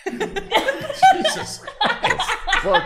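gagging through a laugh; retching, half laughing. Real, not acted. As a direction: choke the words out after retching. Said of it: "I just threw up all over my mouth".

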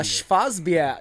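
Schwarzbier in a German accent, "Schwarz" said as "shfahts". Shown as a demonstration. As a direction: say Schwarzbier the German way, hard "pier" at the end. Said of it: "You have to say it with a German accent".

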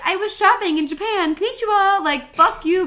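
high, loud sing-out imitation; mock breezy excuse. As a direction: imitate her airily flaunting the trip. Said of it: "She was just like".

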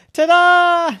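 high, loud drawn-out fanfare; triumphant ta-da. A narrator's reading. Little gymnast announces herself to the family, triumphant. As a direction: belt it out like a little show-off. Child demonstrates teacher's ta-da.

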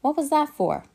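high, loud what was that for; indignant surprise. Acted. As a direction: yelp it out, stung and put out. Hit by a pepper shaker.

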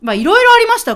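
high, loud host wrap-up; excited wrap-up. Real, not acted. Host wrapping up the Christmas episode, bright and loud. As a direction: lift the energy to close the segment.

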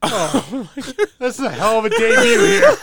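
high, loud words bursting into laughter; laughing hard. Real, not acted. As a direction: crack up while getting the line out. Co-host overcome by laughter.

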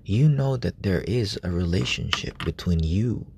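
ordinary talk

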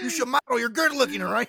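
loud, high mock compliment; imitating angry potato. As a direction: bellow the compliment as a grumpy friend. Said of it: "angry potato consistently saying".

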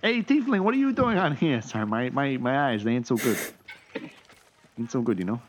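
put-on poor New York accent. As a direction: call out in a bad New York accent, then ease down. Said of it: "really poor New York accents".

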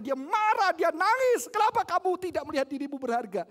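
preacher shouting with passion; full-force preaching. Acted. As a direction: hammer it home to the congregation.

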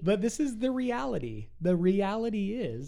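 declared high and loud, stressing reality; emphatic pronouncement. Acted. Boyfriend announcing his favorite line, a loud emphatic declaration. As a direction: lay down the reality like a rule. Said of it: "He loves to say that".

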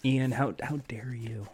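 very soft, low reproach; barely audible pretend outrage; mock wounded. Exposed friend reproaches Ian, hushed mock hurt.

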